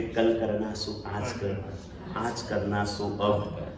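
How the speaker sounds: slow, soft proverb recital; measured, quiet reciting of a saying; slow recitation. Retold or imitated. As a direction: recite the couplet slowly like a lesson.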